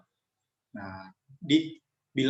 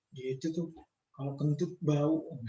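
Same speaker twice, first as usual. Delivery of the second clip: softer, secretive backbiting murmur; gossipy hush; 6 dB quieter than usual. Acted. A student gossiping about a friend who just left, hushed. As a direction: lean in and dish the dirt quietly.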